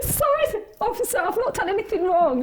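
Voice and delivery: high, laughing, sheepish sorry; mock nervous apology. Apologising to the New York immigration officer, high and laughing. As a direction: plead innocence in a squeaky laughing voice.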